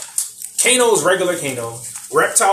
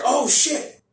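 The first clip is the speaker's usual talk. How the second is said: high, loud disbelief; imitated shocked outburst; mock astonishment; 11 semitones higher, 5 dB louder than usual. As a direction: exclaim as if stunned, hammed up. Acting stunned reaction to laser beam.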